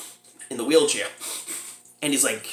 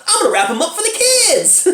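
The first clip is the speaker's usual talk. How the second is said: very high caricature of a gleeful mom; mock excited mother; 4 dB louder than usual. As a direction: squeal it like a thrilled mother, on the edge of laughter. Imitating mother delighted by lawn darts.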